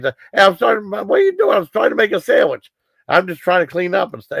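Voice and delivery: high, exasperated yelp of disbelief; indignant surprise. Retold or imitated. Man caught making a sandwich in the argument, high indignant surprise. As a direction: squeak out what are you doing. Acted quarrel over the sandwich.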